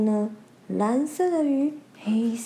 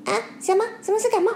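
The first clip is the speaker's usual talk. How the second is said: shocked squeak; 10 semitones higher, much faster than usual. Acted. Panicking little fish.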